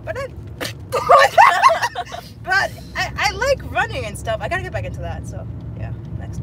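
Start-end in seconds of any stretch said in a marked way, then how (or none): 0.3-2.4 burst of laughter. Real, not acted. Friend reacts to self-mockery.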